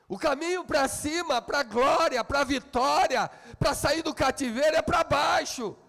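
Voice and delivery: mais agudo e mais alto, gritando a frase; pregação inflamada. Acted. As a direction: crescendo até o grito do púlpito. Preaching builds to shout. (pregação gritada com força)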